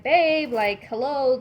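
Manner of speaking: put-on calling boyfriend across the room. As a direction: call out to boyfriend, half scared.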